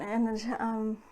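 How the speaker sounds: ordinary talk, nothing marked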